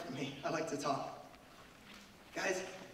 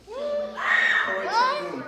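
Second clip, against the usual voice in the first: "Corrie ten Boom" sung out much higher, slow and loud; drawn-out name; 10 dB louder, much slower than usual. Shown as a demonstration. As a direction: stretch "Corrie ten Boom" high and long, like calling it out.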